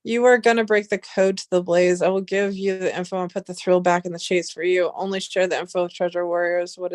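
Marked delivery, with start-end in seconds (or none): none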